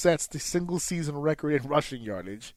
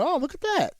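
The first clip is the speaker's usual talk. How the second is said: mock pleasant; 11 semitones higher than usual. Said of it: "And I went".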